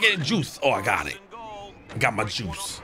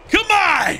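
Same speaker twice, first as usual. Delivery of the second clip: frustrated shout; 4 dB louder than usual. Real, not acted. Frustrated plea during game.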